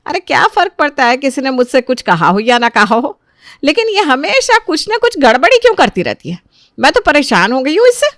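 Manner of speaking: high-pitched exasperated complaint; fed up, pitch climbing as the tirade builds; angry. Acted. Mother-in-law complains of daughter-in-law's constant trouble, angry. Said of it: "माया देवी बहुत गुस्से में थी".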